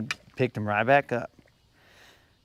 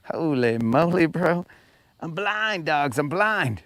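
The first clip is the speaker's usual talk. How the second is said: surprised; 5 semitones higher, 5 dB louder, slower than usual. Real, not acted. Sun glare blinds him while fishing.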